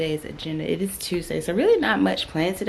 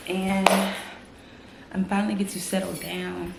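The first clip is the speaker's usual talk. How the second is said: ordinary talk, nothing marked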